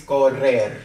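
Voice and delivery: "correr" slowly with a trilled double r; rolled R. Shown as a demonstration. Teacher telling learners to roll it, "correr" with trilled rr. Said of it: "We roll it".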